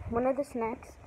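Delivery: ordinary delivery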